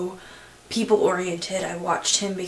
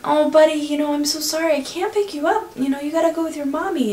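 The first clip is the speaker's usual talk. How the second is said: gentle husband; 8 semitones higher than usual. Retold or imitated. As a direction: sweetly turn the little boy away. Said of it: "my husband".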